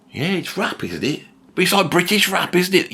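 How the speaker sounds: imitating a street rapper. Rapper watching Blur on TV, mock high agreeing. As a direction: mimic a mate agreeing, up high. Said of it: "they go".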